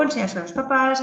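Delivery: ordinary talk, nothing marked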